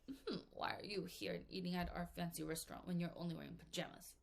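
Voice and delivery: a hint of a sneering waiter, soft; snooty waiter. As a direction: look down your nose at the guest. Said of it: "the waiter is like".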